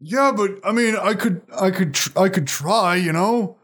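high-pitched, faltering excuse; dejected henchman. Acted. Outnumbered henchman answering the party, feebly defiant and halting.

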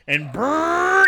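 mock motor rev; dirt bike engine noise.

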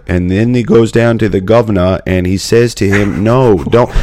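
mock British accent of a pitcher; hammed-up British storyteller pitching an idea. Imitating British people pitching at the festival, mock British accent. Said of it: "but British people pitching".

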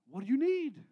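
calling out. Acted. Pastor calling out to congregation.

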